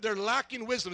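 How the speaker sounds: ordinary delivery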